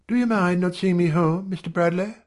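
high-pitched female character; high, polite question; woman's request. A narrator's reading. Janet addresses the narrator.

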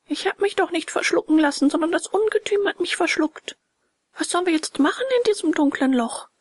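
high, quick puppet voice; agitated little puppet. Acted. Puppet to dolphin inside the shark, agitated and high. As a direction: protest fast in a high-pitched voice.

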